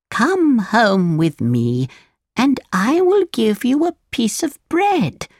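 put-on drawn-out, caring old woman; old woman, kindly. A narrator's reading. Old woman speaks to tired Pinocchio, slow kindly voice.

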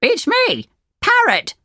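very high bird character; storybook parrot squawk. A narrator's reading. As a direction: announce yourself as a high-pitched parrot. Parrot announces itself from tree.

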